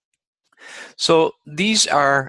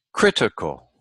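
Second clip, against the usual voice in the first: critical with CRIT stressed; critical, demonstrated with stress up front; stress on third-last syllable. Shown as a demonstration. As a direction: hit the first syllable of critical, then fall. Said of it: "third syllable from the end".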